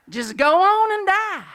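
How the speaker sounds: high, loud, drawn-out mock holler; hammed-up shout. Quoting the reverend's advice to the congregation, hammed-up holler. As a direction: belt out the punchline in mock-wise exclamation.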